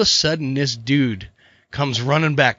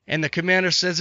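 usual speaking voice

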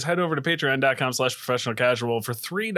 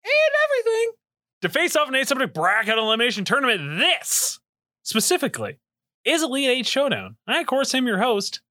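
high, showy announcer voice; ringmaster; 9 semitones higher than usual. Acted. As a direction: hype up the show like a ringmaster. Podcast intro announcer.

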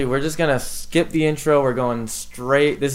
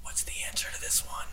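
soft whisper, sneaky; 20 dB quieter than usual. Retold or imitated. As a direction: whisper it like cheating in class. Classmate asks in quiz.

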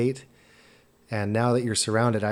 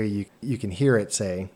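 ordinary talk, nothing marked

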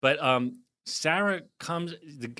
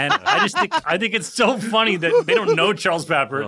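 laughing talk; 6 semitones higher, 9 dB louder than usual. Real, not acted. Laughing at listeners' singing.

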